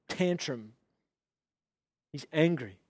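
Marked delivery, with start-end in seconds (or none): none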